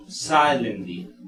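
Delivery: silently drawn out slowly, first syllable strongest; slow, falling silently, weight up front; a teacher's slow model of silently; stress on first syllable. Shown as a demonstration. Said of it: "Mayor fuerza de voz en si".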